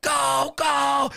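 high-pitched panicked yell; drawn-out mock scream; retold screaming. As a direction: imitate a carload screaming to get away. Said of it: "everyone starts screaming".

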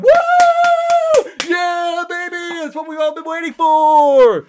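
high, loud hammed-up woo; mock cheering. Sarcastic fan mocking the viewers' missing joy, fake whooping. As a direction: fake over-the-top celebration cheer. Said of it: "cheering, going".

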